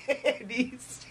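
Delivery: normal talking voice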